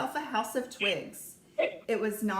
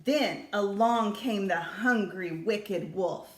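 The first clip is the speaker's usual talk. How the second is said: usual speaking voice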